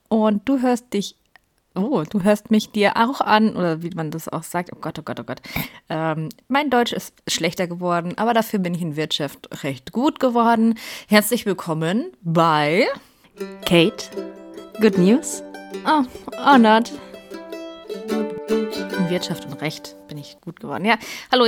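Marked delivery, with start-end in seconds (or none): none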